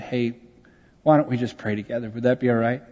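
soft, low, tender invitation; gentle offer. Retold or imitated. Pastor holding the daughter's hand, gently offering prayer. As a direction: ask quietly and kindly, as retold.

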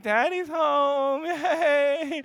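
high, slow, fake-crying drawl of boredom; a sulky kid faking enthusiasm; mock-weepy teen. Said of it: "he's like".

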